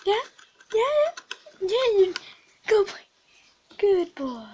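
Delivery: high squeaky praise; excited puppy talk; dog-talk voice. Real, not acted.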